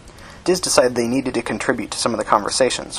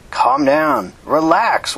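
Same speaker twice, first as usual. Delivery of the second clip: high, raised appeal for calm; urgent calming; 13 semitones higher, 4 dB louder, much slower than usual. Acted. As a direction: call over a quarrelling group to settle it. Bailey quiets an arguing group.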